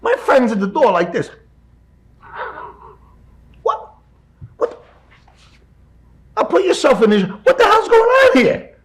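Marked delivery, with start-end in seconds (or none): 3.6-8.8 high, loud disbelieving cry; the friend can't believe what he's seeing; stunned outburst. Retold or imitated. Friend at the door, shocked at the sight, loud and high.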